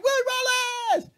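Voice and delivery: high, loud hammed-up yell; mock battle cry. Host cheering the Braveheart-sounding debater, mock battle yell. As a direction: holler it like a playful war cry.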